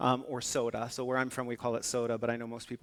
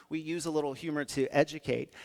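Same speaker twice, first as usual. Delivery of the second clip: usual speaking voice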